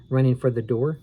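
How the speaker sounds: usual speaking voice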